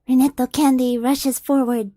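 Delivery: normal talking voice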